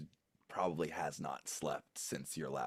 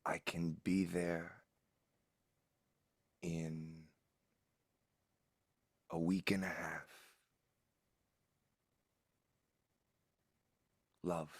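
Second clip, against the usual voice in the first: tired voice. Acted. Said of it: "a very tired voice".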